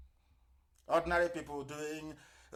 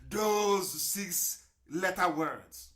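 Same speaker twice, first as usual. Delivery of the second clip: very slow repeat; much slower than usual. Real, not acted. Repeats the phrase even slower.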